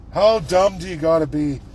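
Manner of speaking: ordinary talk, nothing marked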